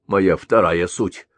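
high, loud scornful rebuke; sharp retort. Acted. Elder snapping at his lounging second self. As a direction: cut him down in scorn. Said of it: "обрезал его Большеголовый".

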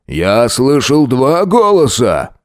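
slow booming thug voice; a big slow brute bellowing; booming bass brute. A narrator's reading. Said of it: "гулким басом заявил громила".

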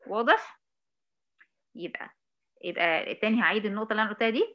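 ordinary delivery

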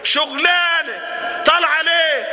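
high, drawn-out scolding question; mock indignant challenge. Preacher challenging a girl at a protest, indignant. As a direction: call her out, pitch jumping up.

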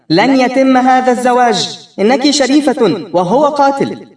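a mother in judge's robes shouting from the stage. Acted. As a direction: proclaim it like a stern judge yelling. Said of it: "وتصرخ بنا".

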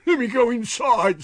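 high, loud desperate plea; shrieking plea. A narrator's reading. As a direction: beg in terror. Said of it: "shrieked aloud".

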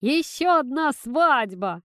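high, loud, slow cheer; drawn-out jubilant cry; excited shout. A narrator's reading. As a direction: shout it out in celebration. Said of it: "кричал Тимоха".

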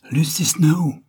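high, overexcited cry; a bit hysterical. Acted. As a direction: fling the name out, almost shrieking with excitement. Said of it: "d'une voix un peu hystérique". (cri aigu, un peu hystérique)

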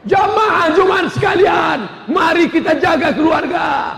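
hammed-up Friday preacher opening; mock khotib. (khotib Jumat berseru dari mimbar)

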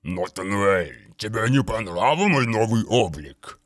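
gurgle it out like a rotting daemon; gurgling voice. A narrator's reading. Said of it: "пробулькал омерзительный голос".

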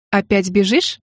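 mocking. A narrator's reading. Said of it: "насмешливое".

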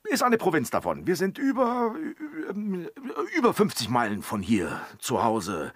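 high, loud fumbling evasion; nervous high-pitched bluffing; flustered bluff. Acted. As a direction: stammer out a fib in a high voice. Bluffing, flustered about origin.

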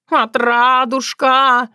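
high, slow wail of a name; howling cat. A narrator's reading. Cat begging the girl for help, howling. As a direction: yowl pleadingly, vowels stretched. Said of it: "взвыл кот".

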